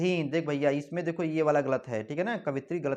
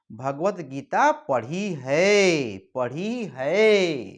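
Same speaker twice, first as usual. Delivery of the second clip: very slow, each word spelled out, stress on पढ़ी है; slow emphatic correction; 4 dB louder, much slower than usual. Shown as a demonstration. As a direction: drag out पढ़ी है so students remember it. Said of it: "ये अशुद्ध वाक्य है". (बहुत धीरे, पढ़ी है पर ज़ोर)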